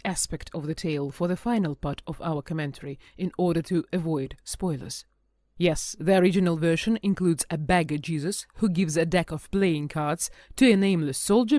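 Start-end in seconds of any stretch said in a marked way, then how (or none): none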